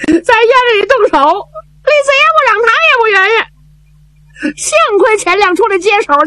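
lightly voiced, high and hurried, sobs breaking in; tearful young man. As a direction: tell the fight fast, choked up. Zhao Bi recounts the fight. (赵弼哭诉打斗经过，语速急)

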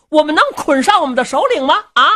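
ordinary delivery

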